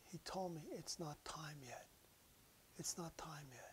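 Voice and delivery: hushed, breathless whisper of a dying woman; awed whisper. Retold or imitated. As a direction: whisper it as if he stands right there. Dying woman speaks softly.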